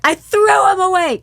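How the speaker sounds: put-on high, loud child's reply; kid voice. Son tells his dad he tossed them, high and loud. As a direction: answer like a kid.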